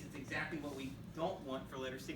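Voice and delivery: ordinary delivery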